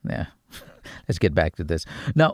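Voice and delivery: ordinary talk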